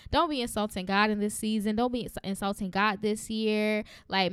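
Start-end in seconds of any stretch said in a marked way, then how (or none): none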